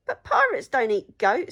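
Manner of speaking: loud protesting pirate; loud objection. A narrator's reading. Pirate chief protests to the troll, loud. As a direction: insist pirates never eat goat.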